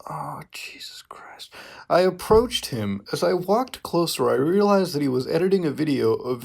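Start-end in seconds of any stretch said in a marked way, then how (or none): 0.1-1.5 whispered in disbelief. Real, not acted. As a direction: breathe it out in horror. Reacting to cringe fanfic line.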